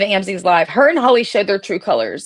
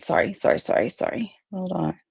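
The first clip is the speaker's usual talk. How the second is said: whispered aside; 9 dB quieter than usual. Real, not acted. Aside while finding place.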